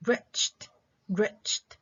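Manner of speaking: "wretched" modelled twice, stressed on WRETCH; first-syllable stress. Shown as a demonstration. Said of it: "examples of stress on the first syllable".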